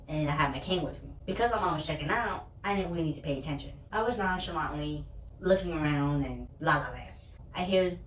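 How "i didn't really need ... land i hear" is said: ordinary delivery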